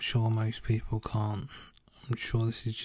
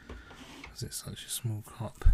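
whispered aside; 13 dB quieter, slower than usual. Real, not acted. Muttered aside about tea.

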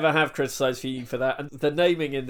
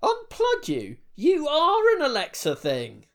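much higher, incredulous squeal; mock shock; 14 semitones higher, 4 dB louder than usual. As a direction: caught out in disbelief. Shocked discovery in sketch.

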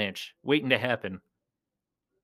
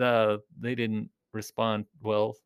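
usual speaking voice

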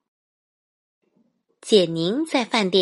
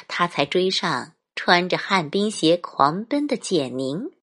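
ordinary delivery, nothing marked

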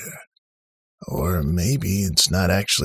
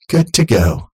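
cheerful automated voice; 3 semitones higher, 7 dB louder than usual. A narrator's reading. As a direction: greet them like a chirpy robot host. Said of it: "the voice said from the front".

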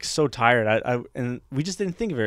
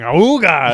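loud honking noise; imitated car horn; 3 semitones higher, 10 dB louder than usual. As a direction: honk like an old car. Old cars honking at them.

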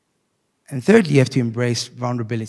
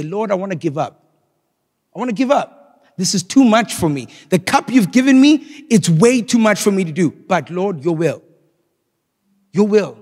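Jesus pleading; 7 semitones higher, 4 dB louder than usual. Retold or imitated. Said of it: "what did he say? Essentially".

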